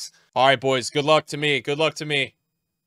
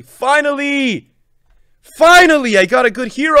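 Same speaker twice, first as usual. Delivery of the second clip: relieved celebration; 4 semitones higher, 7 dB louder than usual. Real, not acted. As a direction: savour it loudly, at long last.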